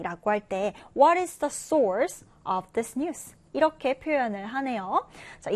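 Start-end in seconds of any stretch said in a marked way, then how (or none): 0.9-3.3 very slow careful English question; slow model English question. Shown as a demonstration. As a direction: read slowly for learners. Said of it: "reading model sentence". (아주 천천히 영어 예문 질문)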